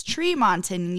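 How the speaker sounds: slow, careful Tremonton, TREE-mon-ton; pronunciation demo. Shown as a demonstration. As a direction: teach Tremonton with a long "ee" in the first syllable. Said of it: "how you pronounce our small city".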